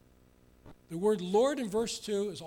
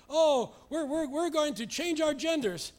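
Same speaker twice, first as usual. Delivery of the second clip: higher, louder mock boast of the proud; 6 semitones higher, 5 dB louder than usual. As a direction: ham up the rebels' bragging. Mocking rebels' boasts.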